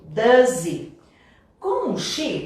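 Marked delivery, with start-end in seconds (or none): 0.0-0.9 DOES HE as a louder "duh-ZEE"; H dropped. Shown as a demonstration. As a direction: join DOES HE on a Z, no H. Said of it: "a gente já viu que sumiu".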